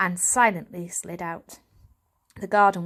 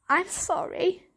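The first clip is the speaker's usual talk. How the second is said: croaked; 9 semitones higher than usual. Acted. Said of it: "croaked Varjak".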